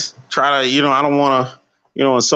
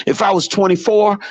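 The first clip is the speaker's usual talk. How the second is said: ordinary talk, nothing marked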